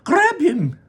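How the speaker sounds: high, sharp command from Mister Wonka; urgent order. Acted. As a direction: bark the order fast. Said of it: "ordered Mister Wonka".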